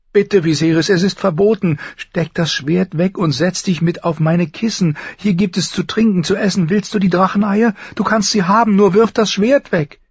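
high, urgent, mounting plea; pleading. A narrator's reading. Sister begging her brother to sheathe the sword, urgent high pleading. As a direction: beg in a high voice, pressing harder towards the end. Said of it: "flehte sie ihn an".

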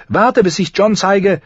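put-on high, eager girl's voice; bursting to show her brother. A narrator's reading. Arya eagerly tells her teacher about showing Jon, in a high voice. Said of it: "sagte Arya eifrig".

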